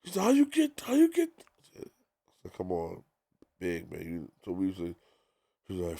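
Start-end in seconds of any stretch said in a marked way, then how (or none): 0.2-1.3 much higher, stunned voice; shocked question. Retold or imitated. Big shocked the DJ already has his song, high and stunned. 2.6-4.0 sheepish. Retold or imitated. Sheepish reply retold.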